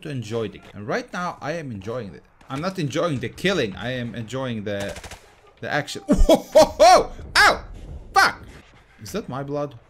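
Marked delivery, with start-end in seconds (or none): 6.1-8.4 high, loud yelp and curse; yelping when hit. Real, not acted. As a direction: cry out as if hit. Gets hit in the game.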